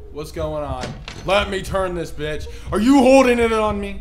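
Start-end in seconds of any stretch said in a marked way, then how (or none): 2.7-4.0 high, loud outraged yell; loud shout. Real, not acted. Player yells at the figure upstairs, loud and high. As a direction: shout it up at them.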